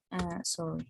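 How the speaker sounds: ordinary talk, nothing marked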